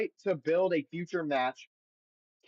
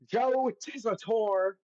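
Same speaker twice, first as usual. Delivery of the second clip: slow, drawn-out British accent, pitched higher; a mock British announcer stretching the name; bad British accent; 6 semitones higher, slower than usual. Shown as a demonstration. Host says the commentator's name in a bad British accent. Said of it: "I don't know why I say his name like that".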